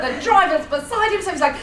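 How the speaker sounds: normal talking voice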